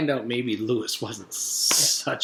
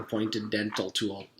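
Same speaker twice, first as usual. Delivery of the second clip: usual speaking voice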